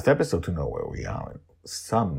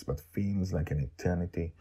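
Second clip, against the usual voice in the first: ordinary delivery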